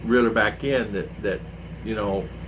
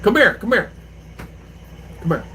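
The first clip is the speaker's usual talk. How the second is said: calling out; 9 semitones higher than usual. Real, not acted. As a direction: call her over, raised and high. Calling someone over to phone.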